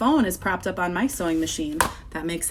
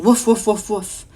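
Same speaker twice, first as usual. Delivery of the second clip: barking dog; 3 semitones higher, 6 dB louder than usual. Acted. Teacher voices pet store dog for children, loud barking. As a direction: bark out the dog's woofs. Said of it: "Here's the dog saying".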